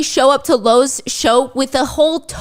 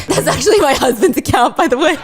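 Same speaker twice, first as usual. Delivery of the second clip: ordinary talk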